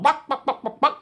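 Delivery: high, loud clucking hen; high hen squawk. Acted. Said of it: "the magical Zen hen said".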